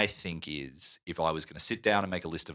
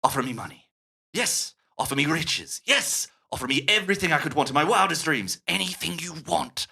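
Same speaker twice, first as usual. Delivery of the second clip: villain bargaining; 7 semitones higher than usual. Retold or imitated. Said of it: "he says".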